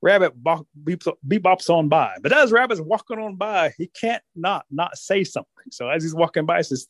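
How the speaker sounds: usual speaking voice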